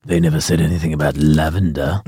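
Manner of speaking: deadpan loophole bloke, low and hushed; gym bloke. Retold or imitated. Gym bloke defending his lavender oil, low and quiet. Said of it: "he came in one day and was like".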